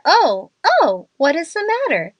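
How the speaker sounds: acted sudden fright, pitch leaping up; startled alarm. A narrator's reading. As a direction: break off mid-word and cry out in fright. Horse breaks through ice mid-sentence.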